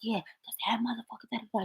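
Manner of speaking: secretive hushed whisper; barely-there gossiping whisper; really whispering. Retold or imitated. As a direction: whisper it so nobody in the next room hears. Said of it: "whispering, barely saying".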